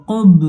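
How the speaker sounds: "কুব" with a bouncing release on the final b; qalqalah. Shown as a demonstration. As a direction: echo the closing ব of "কুব" crisply. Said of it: "তাহলে কি কলকলা হবে".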